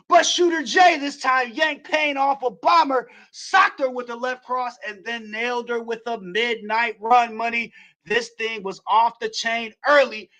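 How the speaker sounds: excited wrestling play-by-play; play-by-play call. Acted. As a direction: call the match like a ringside announcer.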